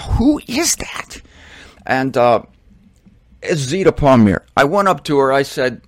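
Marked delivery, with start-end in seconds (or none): 0.0-1.2 much higher, slow, squinting question; high drawn-out puzzled question; puzzled who is that. Retold or imitated. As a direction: wonder aloud who this familiar woman is.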